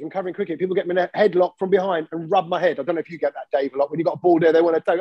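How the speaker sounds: ordinary talk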